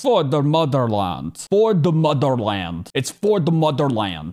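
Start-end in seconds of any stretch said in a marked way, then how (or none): 0.0-1.5 mock Russian accent, hammed-up patriotic cry; a badly faked Russian accent, proud and overdone; terrible Russian accent. Reviewer replaying the scientist's last words in a bad Russian accent. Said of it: "That was a terrible Russian accent". 1.5-2.9 a mock Russian accent with For punched; badly faked Russian accent, For hit hard and falling; terrible Russian accent. Reviewer repeating the scientist's cry in a bad Russian accent. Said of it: "That was a terrible Russian accent".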